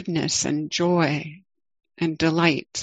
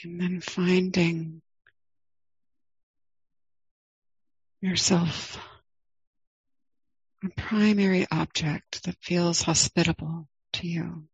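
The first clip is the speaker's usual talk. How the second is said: guided meditation. Acted. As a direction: ease listeners into the body, gently.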